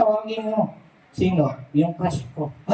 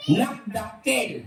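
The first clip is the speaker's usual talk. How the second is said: drawn-out answer; much slower than usual. Real, not acted. Contestant answers the big-duck riddle, drawn out. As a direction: stretch NAGDAKIL out very slowly.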